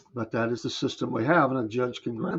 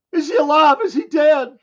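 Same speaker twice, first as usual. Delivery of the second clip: much higher, louder panicked shouting; frantic neighbors; 14 semitones higher, 9 dB louder than usual. Retold or imitated. Neighbors shouting over bleeding boy, panicked cries. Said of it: "the neighbors are going".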